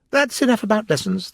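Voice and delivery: very decided. A narrator's reading. Gryphon ending the lesson talk, very decided. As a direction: shut the topic down firmly. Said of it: "in a very decided tone".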